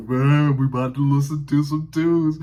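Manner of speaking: booming teacher impression, loud and pushed; Mister Blackwell's deep voice. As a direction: boom it out like a big, deep-voiced man. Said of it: "He had that deep voice".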